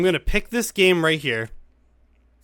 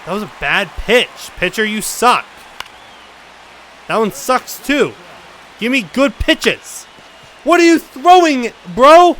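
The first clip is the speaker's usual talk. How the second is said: frustrated shouts, getting louder and higher; angry yelling; 8 semitones higher, 9 dB louder than usual. Real, not acted. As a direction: berate the pitcher, building to a yell. Yelling at the CPU pitcher.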